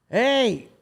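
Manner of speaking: high, casual mock-friendly greeting; imitating the driver. Driver greets the stranded Marine in a high, breezy mock voice. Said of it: "the guy that's driving's like".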